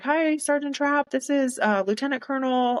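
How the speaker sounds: high, slowed introduction over the phone; another woman's voice. Retold or imitated. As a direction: play the lieutenant colonel announcing herself. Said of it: "I hear this other woman's voice".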